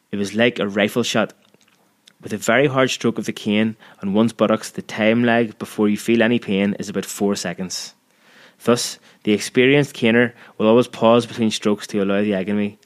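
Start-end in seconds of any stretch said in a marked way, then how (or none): none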